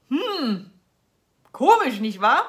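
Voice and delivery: loud, sarcastic faux wonder; mock puzzled irony. Real, not acted. Vlogger mocking the shrinking packs with sarcastic wonder. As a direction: feign surprise, then hit it hard.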